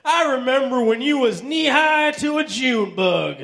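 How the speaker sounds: high-pitched old grandpa impression; folksy grandpa. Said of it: "my grandpa always says the same thing".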